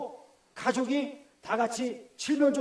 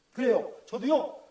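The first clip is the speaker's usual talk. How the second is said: usual speaking voice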